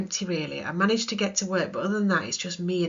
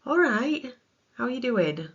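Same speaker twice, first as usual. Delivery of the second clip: gentle greeting; 5 semitones higher, 3 dB louder than usual. Retold or imitated. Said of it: "What's needed here?".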